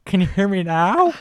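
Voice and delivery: high, slow call into a phone; slow, high cellphone ad voice; Verizon guy impression. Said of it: "he was the spokesman for Verizon".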